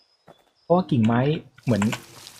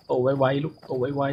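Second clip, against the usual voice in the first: tender coaxing; 3 dB quieter, much slower than usual. Pretend. Coaxing crops like a father.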